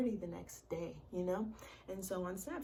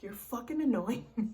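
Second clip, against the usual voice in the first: louder, annoyed "You're fucking annoying me"; snapped retort; 4 semitones higher, 7 dB louder than usual. Retold or imitated. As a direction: snap it at someone crowding you. Snapping at him.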